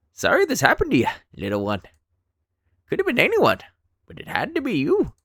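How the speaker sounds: put-on high, loud creaky old man. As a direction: play a cackling old man finding a stranger. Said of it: "let's do old man voice".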